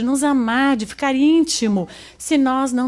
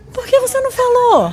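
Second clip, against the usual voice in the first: high-pitched mock exasperation; hammed-up incredulous complaint, voice shot way up; exasperated husband; 11 semitones higher, 4 dB louder than usual. Husband to wife who confessed too late, exasperated.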